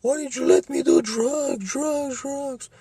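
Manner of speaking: put-on ghostly little boy voice, very high; whiny child ghost. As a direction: wail like a dead child haunting his mother. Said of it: "he's like".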